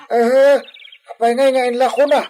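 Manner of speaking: alarmed question. Acted. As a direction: demand why in shock.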